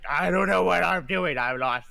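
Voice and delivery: mocking imitation. Captain mocks souls who are lost, loud high whine. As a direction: mimic a helpless lost soul. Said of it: "just like".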